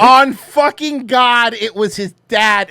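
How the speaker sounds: loud, high, drawn-out oath; stunned punchline delivered at high pitch; emphatic disbelief. Real, not acted. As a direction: swear it loudly, stretching the words. Swears to the shocking punchline.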